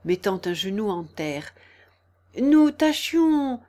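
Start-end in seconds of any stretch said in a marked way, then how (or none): 2.4-3.6 high, drawn-out humble explanation. A narrator's reading. Kneeling gardener explaining to the Queen, humble. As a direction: explain slowly and humbly, pitched up. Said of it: "d'un ton très humble".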